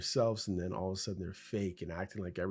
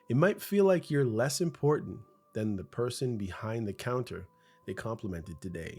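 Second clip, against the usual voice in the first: ordinary talk, nothing marked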